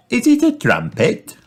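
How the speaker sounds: put-on high-pitched curious child; high, swooping question; Peppa. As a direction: guess eagerly in a little girl's voice. Peppa guesses at her find.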